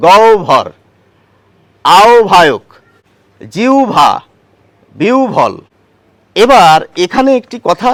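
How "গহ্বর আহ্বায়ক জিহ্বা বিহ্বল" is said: গহ্বর, আহ্বায়ক, জিহ্বা, বিহ্বল with bh for হ্ব; each word said slowly with a bh sound; modern pronunciation. Shown as a demonstration. Teacher listing words in the modern pronunciation. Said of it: "একইভাবে যদি এই শব্দটির উচ্চারণ করি".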